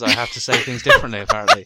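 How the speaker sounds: loud, laughing mock complaint; laughing protest. Real, not acted. Teased by co-hosts for British pronunciation, laughing protest.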